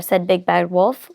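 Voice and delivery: ordinary delivery, nothing marked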